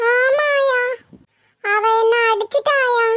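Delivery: put-on whiny little girl telling on someone; drawn-out, tearful child's whine. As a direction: complain to granny in a weepy child voice. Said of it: "எதுக்கு அழுகுற".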